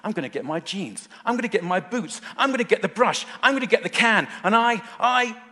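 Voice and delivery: acting out a thrilled little boy listing his plans; excited child. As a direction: tumble it out like an excited small son, climbing to a stammer. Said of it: "He was excited".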